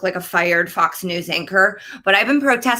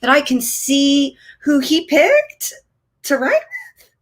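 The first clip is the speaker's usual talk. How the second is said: high, slow, dragged-out incredulous question; jealous suspicion; 9 semitones higher, slower than usual. Retold or imitated. Wife spotting husband's Peloton instructor, slow high disbelief. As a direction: stretch it out in mock disbelief. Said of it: "I thought to myself".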